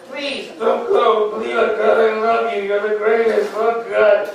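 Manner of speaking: loud, drawn-out hammed-up plea; mock begging. Man begging his date to stay, hammed-up desperate pleading. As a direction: beg at full comic desperation. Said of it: "I beg for her to stay".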